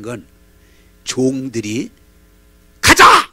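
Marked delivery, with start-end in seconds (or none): 2.8-3.3 loud, high shouted command; angry shout. Retold or imitated. Naaman losing his temper at his servants, shouting. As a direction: yell it in a temper. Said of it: "막 성기를 내면서".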